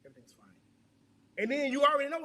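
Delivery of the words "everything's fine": very quiet, low mumbled reply; retold dismissive answer. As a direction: imitate a partner brushing off the question, barely audible. Retold answer from upset partner.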